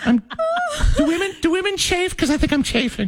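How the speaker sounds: put-on high, laughing pageant girl complaint; giggly beauty queen, squirming; man doing a woman's voice. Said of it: "sound like a female actress".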